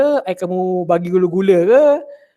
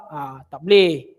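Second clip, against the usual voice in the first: ordinary delivery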